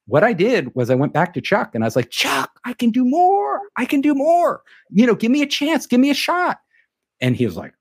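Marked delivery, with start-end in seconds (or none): 2.1-4.6 high, pushy pleading voice; eager pleading. Retold or imitated. Young reader begs his boss Chuck for more work, high and eager. 4.9-6.6 high, eager "give me a shot"; eager pleading. Retold or imitated. Reader asks his boss for a shot, high and eager.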